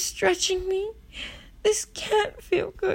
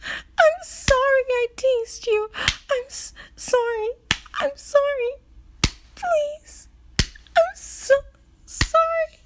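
high, halting, broken apology; faltering, desperate apology; pleading apology; 5 semitones higher than usual. Acted. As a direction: plead in a raised pitch, catching on the words. Acted desperate apology, breaking up.

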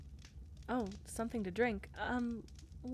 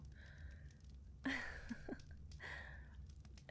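soft giggle; 4 semitones higher, 13 dB quieter than usual. Acted. Shy laugh after confession.

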